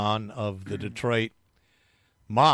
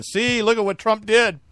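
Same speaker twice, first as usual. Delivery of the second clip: higher-pitched imitation of public outrage; mock outrage; 7 semitones higher, 3 dB louder than usual. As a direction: exclaim like an indignant crowd, half in jest. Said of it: "everybody was outraged, saying".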